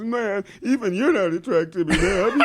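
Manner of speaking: high, half-laughing voice of a miffed buddy; offended friend. Retold or imitated. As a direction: imitate the friend's wounded pride. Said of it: "He goes".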